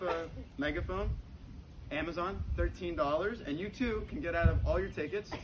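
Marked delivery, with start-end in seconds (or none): none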